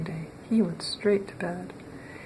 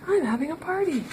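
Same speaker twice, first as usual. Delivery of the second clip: put-on much higher, girlish party voice; excited little girl; 10 semitones higher, 3 dB louder than usual. Little Miss Fun inviting Mister Grumble to her party, high and girlish. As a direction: bubble over like a little girl. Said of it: "she cried".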